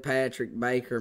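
usual speaking voice

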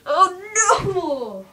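high, loud wail; dismayed cry. Pretend. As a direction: cry out in play alarm. Character in trouble in play.

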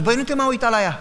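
acted chiding. Others tell him to stop staring.

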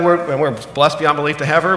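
usual speaking voice

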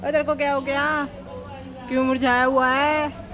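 ordinary talk, nothing marked

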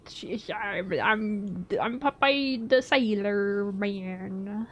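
botched Newfoundland accent that slides into Popeye; slow, halting stab at a Newfoundland accent; badly faked Newfoundland accent, stumbling at first. Shown as a demonstration. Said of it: "Do a Newfoundland accent".

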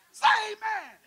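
high shouted amen call; preacher demands amen from congregation, shouted high; loud call-out. Real, not acted. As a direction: call the church to answer. Calls out to congregation.

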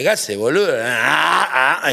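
brash, loud porteño greeting; loud Argentine calling out; loud Argentine guy. Retold or imitated. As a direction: play the loud Argentine bloke. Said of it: "escuchar a un argentino".

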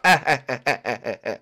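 staccato put-on cackle; repeated mock "ha" bursts; cartoon joke laugh. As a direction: laugh at your own dad joke. Laughing at own dad joke.

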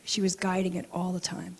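ordinary talk

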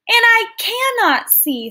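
startled mouse. Acted. Mouse realizes he's blind.

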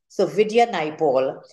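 normal talking voice

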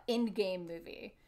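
ordinary talk

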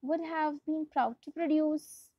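plain reading voice

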